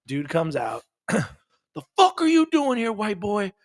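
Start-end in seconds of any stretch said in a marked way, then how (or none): 1.1-3.5 high, loud hostile yell from a stranger; angry homeowner. Retold or imitated. Homeowner yelling at the meter reader, high and loud. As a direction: bark it like a territorial homeowner.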